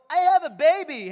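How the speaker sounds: loud, high-pitched angry yell; outraged shout, played for laughs; retold shout. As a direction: re-enact an indignant yell at another driver. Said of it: "sumigaw talaga ako eh".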